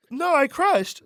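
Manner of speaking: very high-pitched wounded self-quote; mock distressed protest. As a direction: protest in a squeaky-high voice, half joking. Retold disbelief at hearing bad audio.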